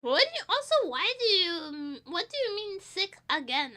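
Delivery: high, loud, incredulous protest; indignant disbelief. Real, not acted. Streamer protesting to chat that she is sick again, incredulous. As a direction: push back at the question, voice jumping up.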